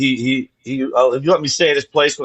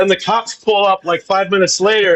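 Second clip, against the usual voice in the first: normal talking voice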